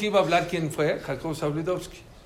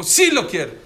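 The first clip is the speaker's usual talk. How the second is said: grito imitado; 3 semitones higher, 8 dB louder than usual. Retold or imitated. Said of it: "Dice que uno gritó".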